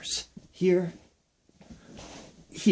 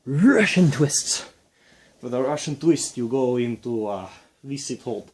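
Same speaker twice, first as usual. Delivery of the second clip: bad Russian accent; 7 dB louder than usual. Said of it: "This is a bad Russian accent".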